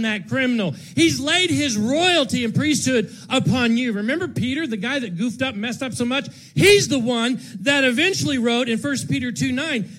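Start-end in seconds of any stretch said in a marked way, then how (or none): none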